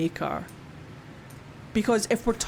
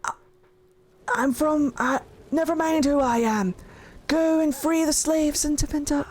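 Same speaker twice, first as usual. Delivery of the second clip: Solas voice; 6 semitones higher than usual. Retold or imitated. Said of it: "And Solas is like".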